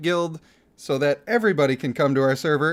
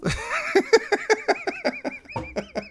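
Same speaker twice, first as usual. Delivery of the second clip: helpless high laugh. Real, not acted. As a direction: burst of giggling way up high. Breaks character laughing.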